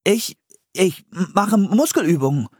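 high, halting answer of someone caught out; stammering. A narrator's reading. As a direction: stumble on the first word, high-voiced. (stammelnd, ertappt, mit hoher Stimme)

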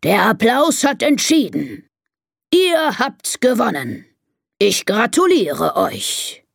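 slow, lightly voiced lion announcement; proclaiming lion. Lion congratulating the elephants, slow proclamation. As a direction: declare it slowly and ceremoniously. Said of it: "ruft der Löwe".

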